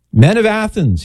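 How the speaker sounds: high-pitched oratorical hail; Saint Paul. Acted. Paul stopping passers-by in Athens, high public address. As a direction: call a street crowd to attention, pitched high. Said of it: "as he says this in the middle of the street".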